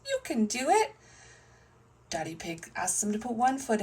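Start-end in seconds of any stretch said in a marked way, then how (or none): 0.1-0.9 high-pitched rallying call; encouraging cheer. A narrator's reading. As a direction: urge the little one on. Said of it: "everybody cheers".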